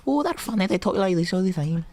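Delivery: very high-pitched comic Scottish voice; mock Scottish accent. Co-host sending up funny Scottish politicians, mock Scottish accent. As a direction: put on a squeaky, exaggerated Scots. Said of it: "Do my Scottish accent".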